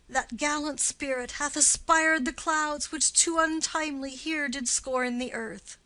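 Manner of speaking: ordinary talk, nothing marked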